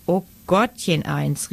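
usual speaking voice

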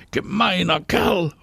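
high, drawn-out angry cry; indignant call. A narrator's reading. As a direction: call the insult out slowly and high. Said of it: "ruft Anna".

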